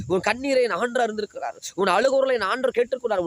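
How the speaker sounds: rising, full-voiced pulpit preaching; fervent preaching. Pastor to a weeping congregation, fervent preaching. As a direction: build the sermon with fire, pitch climbing.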